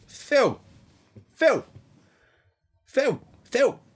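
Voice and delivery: high excited hail; calling out. Retold or imitated. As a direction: yell across the room to get attention. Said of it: "[name] ... is going".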